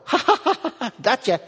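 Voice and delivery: high, teasing cackle of a laughing God. Retold or imitated. God mocking the pastor's bad morning, high teasing laugh. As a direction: tease him with a high gleeful laugh. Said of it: "it was like God go".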